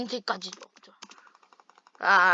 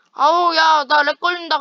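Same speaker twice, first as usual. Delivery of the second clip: higher, louder yell about lag; frustrated shout; 6 semitones higher, 8 dB louder than usual. Real, not acted. Yelling about lag mid-fight. (렉 때문에 답답해서 외침)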